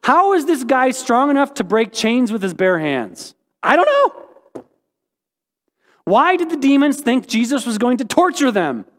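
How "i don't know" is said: very high, slow, mock-helpless "I don't know"; squeaky comic "I don't know". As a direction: squeak it out like a baffled shrug. Comic refrain answering hard questions.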